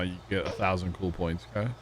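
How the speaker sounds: ordinary talk, nothing marked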